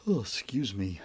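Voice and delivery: real yawn spilling into the words; words swallowed by a yawn. Real, not acted. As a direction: excuse yourself through a big yawn. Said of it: "Big yawn".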